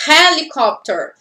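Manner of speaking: "helicopter" with a clearly breathed English H; aspirated H. Shown as a demonstration. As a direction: open "helicopter" on an audible puff of air. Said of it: "se eu escuto o R". ("helicopter" com H aspirado, como R brasileiro)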